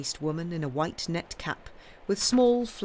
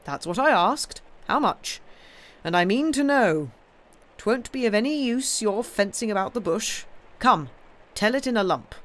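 louder, higher, insistent uncle voice; stern demanding uncle; 6 semitones higher, 6 dB louder than usual. Acted. Uncle pressing Sam about debts, stern demand. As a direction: press hard for a straight answer.

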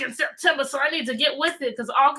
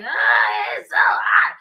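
mock whiny complaint about the heat; a hammed-up heat complaint; mock complaining; 2 semitones lower, 7 dB louder, much slower than usual. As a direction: whine it like someone fed up with the heat. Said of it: "a lot of people, they're like".

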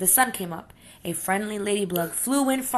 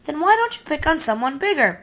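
teasing taunt; 9 semitones higher, 5 dB louder than usual. Acted. Friendly ladybug taunts.

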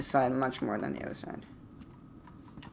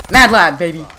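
hype yell; 3 semitones higher, 16 dB louder than usual. Real, not acted. Gamer celebrating a kill, loud hype cheer. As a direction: cheer it like you nailed the shot.

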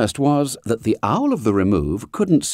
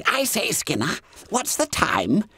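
high, hushed schoolboy whisper; squeaky fat boy whispering in class; Bunter's whisper; 12 semitones higher, 4 dB quieter than usual. A narrator's reading. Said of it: "whispered to Skinner".

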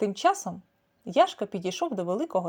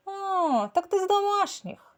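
much higher, drawn-out letdown; disappointed tomcat; 11 semitones higher, 4 dB louder, slower than usual. A narrator's reading. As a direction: tomcat let down by a house cat. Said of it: "розчаровано сказав Яшка". (розчарований високий голос кота)

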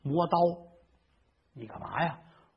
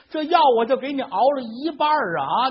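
put-on near-tearful old wife; 7 semitones higher, 5 dB louder than usual. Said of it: "老太太都快哭了".

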